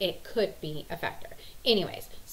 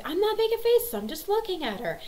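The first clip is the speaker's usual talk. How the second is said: imitating defensive son; 13 semitones higher, 4 dB louder than usual. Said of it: "And he's like".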